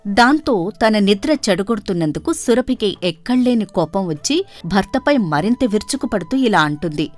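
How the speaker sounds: ordinary delivery, nothing marked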